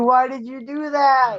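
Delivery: high, slow, drawn-out complaint; exasperated whine. Real, not acted. Scolding a friend over the accidental raid, high and slow. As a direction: stretch it out in mock despair.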